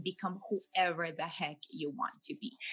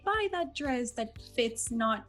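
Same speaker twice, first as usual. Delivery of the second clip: voicing a torn client; 6 semitones higher than usual. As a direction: act out her wistful inner voice, a little higher. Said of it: "they have this conflict of".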